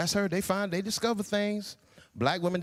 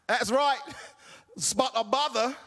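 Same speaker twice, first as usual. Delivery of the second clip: mock British accent; 3 semitones higher than usual. Actor faking British at audition.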